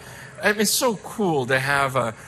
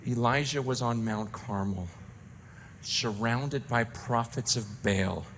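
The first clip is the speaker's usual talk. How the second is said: much softer, low, gently wry; God's voice; 7 semitones lower, 10 dB quieter than usual. Retold or imitated. God gently reminds the pastor, soft and wry. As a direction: speak it softly as God answering. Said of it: "here's what God said".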